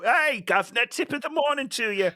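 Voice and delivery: high hammed-up English accent; mock English accent. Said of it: "All English accents".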